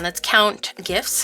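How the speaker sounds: usual speaking voice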